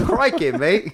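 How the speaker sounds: hammed-up Aussie croc-hunter exclamation; mock Australian. Laughing at the Irwins' show, in mock Australian. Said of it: "that sound like an Irishman".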